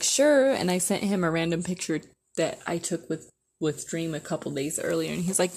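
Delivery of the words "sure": sarcastic sure. Retold or imitated. Sarcastic agreement to creep.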